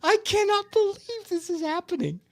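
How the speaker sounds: high, incredulous exclaim; amazed. Retold or imitated. Watching the bare-chested firemen, she exclaims in disbelief. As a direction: gush in disbelief at the sight.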